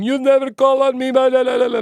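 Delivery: high, loud mocking shout of a reporter who gets ignored. Reporter shouting at the press secretary, mock high loud yell. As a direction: yell it like the reporter demanding a turn. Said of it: "he starts shouting and going".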